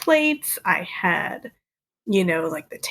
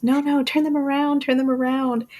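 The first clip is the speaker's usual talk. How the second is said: higher-pitched mock yell; Woody yelling; 6 semitones higher than usual. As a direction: urgently call out instructions, not at full volume. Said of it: "Woody yells".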